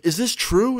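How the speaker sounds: high-pitched retold challenge; mock disbelief. Other guy confronts the cheating girlfriend.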